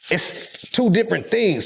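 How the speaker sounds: ordinary delivery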